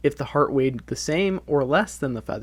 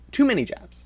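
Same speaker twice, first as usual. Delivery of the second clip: squeaky repeat; 10 semitones higher than usual. Pretend.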